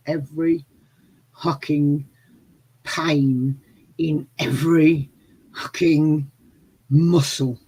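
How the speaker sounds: "every hucking pain", each word slow and separate; word-by-word emphasis. Real, not acted. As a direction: land on "hucking" and "muscle" with a pause before each.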